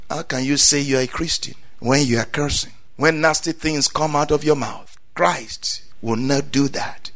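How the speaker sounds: ordinary talk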